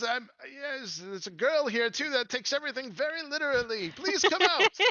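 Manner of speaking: high, exasperated little character; high-pitched theatrical begging; Sir Talavar pleading. Acted. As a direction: plead in a high voice, building to a loud call. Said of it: "He says".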